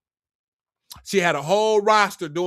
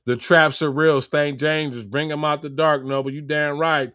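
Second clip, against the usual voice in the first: normal talking voice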